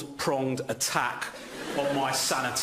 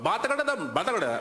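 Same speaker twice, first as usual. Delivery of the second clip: mock foreign gibberish; 3 semitones higher, faster than usual. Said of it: "someone would come up to me and go".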